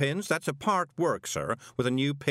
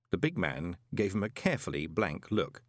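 ordinary delivery, nothing marked

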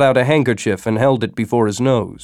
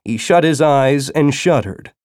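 ordinary delivery, nothing marked

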